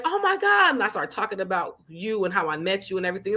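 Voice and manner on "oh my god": high squeal of disbelief; mock shock. Telling a friend about her, squealing in surprise. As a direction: gasp it out high and stunned. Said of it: "I'm like".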